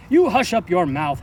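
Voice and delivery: the mighty king howls down at Mac; howled rebuke. A narrator's reading. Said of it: "howled the mighty King Yertle".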